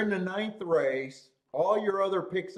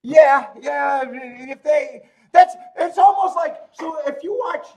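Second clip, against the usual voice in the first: fired-up loud outburst; 8 semitones higher, 10 dB louder, slower than usual. Real, not acted. Fired up about the picks.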